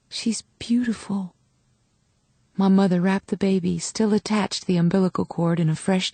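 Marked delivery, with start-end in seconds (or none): 0.1-1.3 slow soft whisper. A narrator's reading. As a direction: reassure her gently, barely voiced. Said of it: "I leaned over to whisper".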